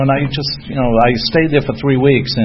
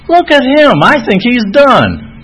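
high, loud Kentucky drawl, imitated; Daryl; 14 semitones higher, 6 dB louder, much slower than usual. Kentucky sponsor sizing up the newcomer, high mocking drawl. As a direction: size him up in a stretched-out Kentucky voice. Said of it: "Daryl's from Kentucky".

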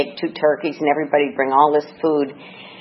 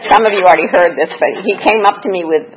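ordinary talk, nothing marked

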